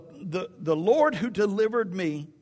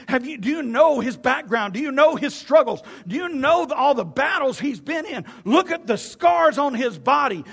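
raised, urgent piling-up of questions; incredulous Saul; 6 semitones higher, 4 dB louder than usual. Acted. Saul warning David about Goliath, agitated disbelief. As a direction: build from disbelief to loud alarm. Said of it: "Saul looks at him and says".

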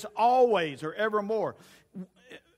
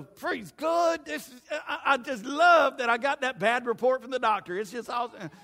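higher, hammed-up forced cheer; fake cheer; 5 semitones higher, 4 dB louder than usual. Pastor mocking fake joy over a bad doctor report. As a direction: pretend bad news is wonderful. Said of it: "We don't put on a face".

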